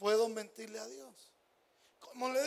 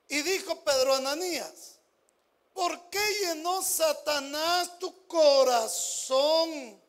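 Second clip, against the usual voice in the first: scripture reading; 6 dB louder than usual. Acted. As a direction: proclaim Peter's rebuke to the congregation. Reads scripture aloud.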